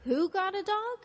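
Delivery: high, astonished "Who got a dog?"; surprised question. Shown as a demonstration. Teacher models asking for clarification, surprised high question. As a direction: pitch way up on "who", incredulous. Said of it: "You're surprised".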